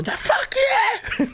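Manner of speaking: snapped out, pitch leaping very high; sudden scream. Retold or imitated. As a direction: bark it mid-sentence as if startled. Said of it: "I'm like".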